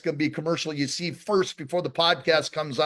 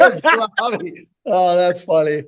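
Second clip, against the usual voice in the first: laughing disbelief; 7 dB louder than usual. Real, not acted. Host echoing the name back to his guest, cracking up. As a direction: stretch it out, cracking up.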